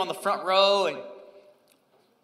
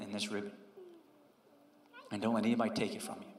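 leise, sanft korrigierender Vater; tröstend und sehr leise, wie ein Vater zum Sohn; gentle correction; 5 semitones lower, 6 dB quieter than usual. Retold or imitated. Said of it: "He just gently corrected".

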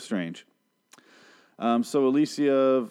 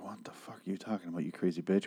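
hushed, fast whispered outrage; Madison's stunned whisper at Alicia; 2 semitones lower, 11 dB quieter than usual. Retold or imitated. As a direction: whisper it in disbelief. Imagined Madison's reaction.

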